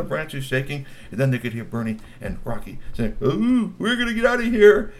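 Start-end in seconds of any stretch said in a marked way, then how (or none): 3.2-4.9 loud high panicked yelp; scared. A narrator's reading. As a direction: cry out in fright. Kids fleeing scared.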